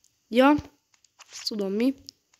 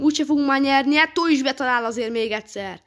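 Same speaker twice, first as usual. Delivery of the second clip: ordinary talk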